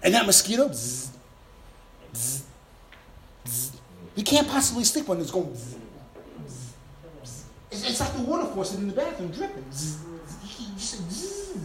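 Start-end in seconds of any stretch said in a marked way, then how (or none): 0.7-3.7 soft lower buzzing bzz; mosquito buzz. Retold or imitated. Preacher imitating a mosquito at bedtime, soft bzz. 6.5-7.4 soft lower buzzing bzz; mosquito buzz. Retold or imitated. Preacher imitating a mosquito at bedtime, soft bzz. 9.6-11.6 mosquito buzz. Retold or imitated. Preacher imitating a mosquito at bedtime, soft bzz.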